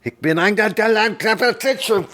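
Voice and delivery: high, slow put-on German grandmother yelling; hammed-up German granny shout; mock German yell. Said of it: "and yell at me".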